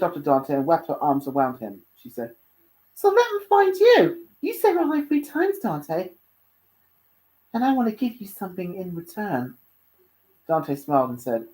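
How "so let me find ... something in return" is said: affectionate Luna. Acted. Luna with her arms around Dante, affectionate.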